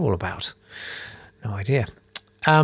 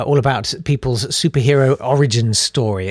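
normal talking voice

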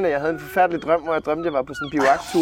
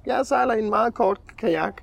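lightly acted caller, higher pitched; mock phone-in contestant, pitched up; a hint of an eager caller; caller on a game show; 7 semitones higher than usual. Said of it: "Så kan hun ringe ind".

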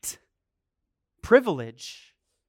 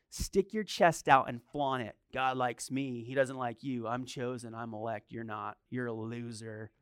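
mock smug sneer; 7 semitones lower, 7 dB quieter than usual.

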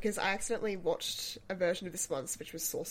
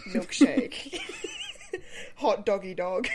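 amused, breaking into laughter; laughing; 9 semitones higher, 11 dB louder, slower than usual. Real, not acted. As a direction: laugh through the silly pet names. Giggling at own silly pet names.

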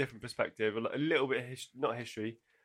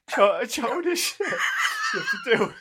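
laughing shout; 9 semitones higher, 6 dB louder than usual. Real, not acted. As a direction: burst out laughing on the words. Cracking up mid-sentence.

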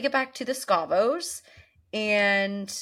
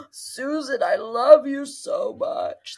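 mock lovestruck plea; 5 semitones higher, 5 dB louder, slower than usual.

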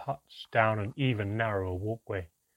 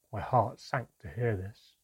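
ordinary delivery

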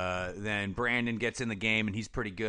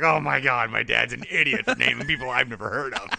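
mocking laugh; 5 dB louder than usual. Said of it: "They're like".

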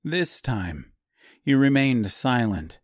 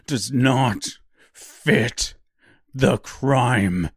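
slow, rising, louder repeat; louder insistence; 3 dB louder, much slower than usual. A narrator's reading. Nicholas repeats his protest to the woman, slow and louder. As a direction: drive the protest home.

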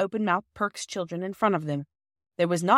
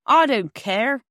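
louder angry outburst; 5 semitones higher, 8 dB louder, slower than usual. A narrator's reading. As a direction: refuse it, loud and angry. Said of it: "rather loud and angry".

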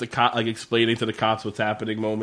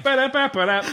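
high, laughing drum-fill imitation; rimshot; 11 semitones higher than usual. After a joke punchline, high, laughing rimshot imitation. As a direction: sing a rimshot sting.

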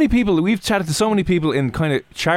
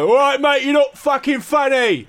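put-on drunk lad heckling; 12 semitones higher, slower than usual. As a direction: yell it at the stage like a boozed-up lad. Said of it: "the lager lads".

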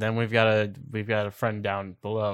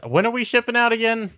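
much higher, louder character voice; 12 semitones higher, 4 dB louder, faster than usual. Acted. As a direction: pitch way up and ask eagerly. Character asks captain.

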